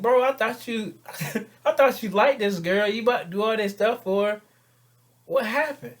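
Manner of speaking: high, loud comic disbelief; astonished questioning. Acted. Man asking his friend about the girl, astonished. Acted surprise at friend's news.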